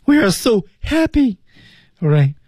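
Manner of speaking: very high-pitched, demonstratively happy. Shown as a demonstration. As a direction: show off how happy you are, pitch way up. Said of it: "高高兴兴的呢". (高高兴兴，音调很高地示范)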